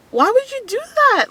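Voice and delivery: squeaky high outraged question; shocked disbelief. Retold or imitated. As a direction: shriek it in mock horror. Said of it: "And I'm like".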